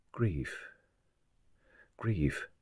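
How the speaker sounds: very soft, sunken single word; hushed grief. Acted. Sculptor dwells on her grief, hushed. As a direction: breathe it out like a private thought. Mourner's thought echoes.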